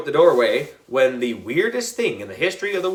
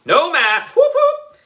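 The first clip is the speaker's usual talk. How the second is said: giggling whoop, much higher at the end; laughing cheer; 4 dB louder than usual. A narrator's reading. Kid celebrates the broken pencil with a giggling whoop.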